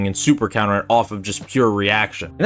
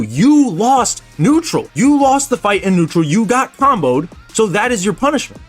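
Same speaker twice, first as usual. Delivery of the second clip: emphatic lecturing; 7 semitones higher than usual. Real, not acted. Heated emphatic point to opponents.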